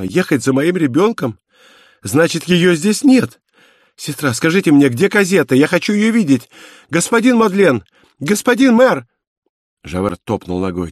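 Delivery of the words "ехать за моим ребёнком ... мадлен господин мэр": high pitch climbing to frantic calls; distraught cries. A narrator's reading. Mother begs for her child, rising desperate cries. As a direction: build from alarm to desperate pleading. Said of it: "закричала она".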